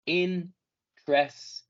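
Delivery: "Interesting" drawn out slowly, three syllables, middle i silent; slow model word. Shown as a demonstration. Teacher modelling "Interesting" slowly for the class. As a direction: stretch "Interesting" slowly as in-tres-ting. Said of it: "esta I es muda".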